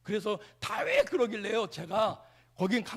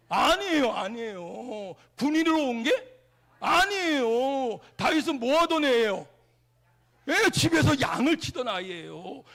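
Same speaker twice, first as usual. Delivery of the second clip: louder, punchy call-and-response; emphatic preaching; 3 semitones higher, 6 dB louder than usual. Acted. Preacher quizzing the congregation in emphatic preaching. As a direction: drive each "아니에요" home.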